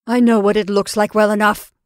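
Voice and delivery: loud, impatient retort; irritated retort. Acted. Caroline silencing her stammering sister, irritated. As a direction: cut her off sharply.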